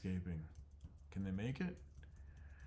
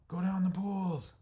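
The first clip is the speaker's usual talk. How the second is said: slow, loud, much higher order; commanding shout; 12 semitones higher, 10 dB louder, slower than usual. Acted. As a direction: bellow at them to move. Chief orders flock down.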